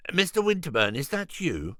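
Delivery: a harried vegan owner answering; Julian's voice. Acted. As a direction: rattle off the shop name. Julian's character voice.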